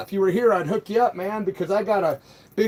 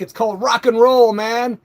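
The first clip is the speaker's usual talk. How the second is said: louder, proud proclamation; defiant declaration; 3 semitones higher, 7 dB louder than usual. Real, not acted. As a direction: defend rock and roll loudly. Defiant reply to a troll.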